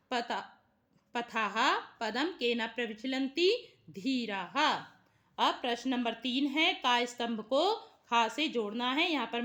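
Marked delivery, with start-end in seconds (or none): none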